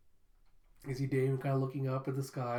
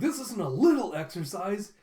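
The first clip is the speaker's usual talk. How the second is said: higher, louder indignant protest; 5 semitones higher, 7 dB louder than usual. Acted. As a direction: push back in alarm. Student protests loudly.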